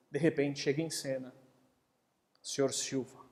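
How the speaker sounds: ordinary delivery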